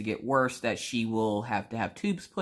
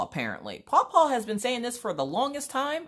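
usual speaking voice

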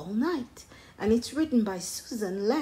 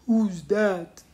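Norman the ape; 6 dB louder than usual. A narrator's reading. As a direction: ask as a puzzled storybook ape.

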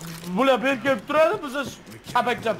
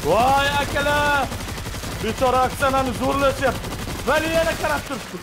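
mock alarmed wail; 6 semitones higher than usual. Player crying to friends about poor Oksana, mock alarmed wail. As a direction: wail in playful alarm, pitched up.

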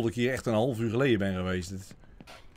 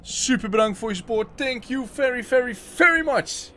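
bright, higher thank-you voice; streamer shoutout; 7 semitones higher, 6 dB louder than usual. Acted. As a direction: hype up the new members. Thanking new members on stream.

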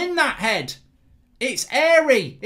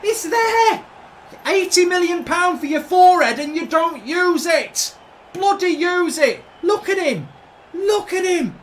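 angry rant; 3 semitones higher than usual. Real, not acted. Ranting at the replay.

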